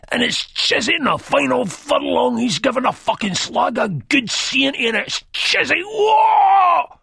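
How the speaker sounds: loud, very high-pitched frenzied commentator; ranting race commentary. Acted. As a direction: call the final furlong, building to a long shouted climax. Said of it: "doing a ranting race commentary".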